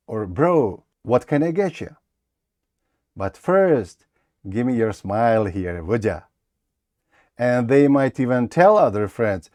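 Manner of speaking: casual American English read; native speaker accent. Shown as a demonstration. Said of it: "Я буду старатися вимовляти так, як вимовляють native speakers".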